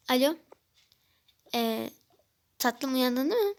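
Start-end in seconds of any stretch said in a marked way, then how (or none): none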